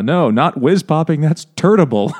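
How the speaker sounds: loud, high giant voice; dopey cartoon bellow. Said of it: "Or, uh".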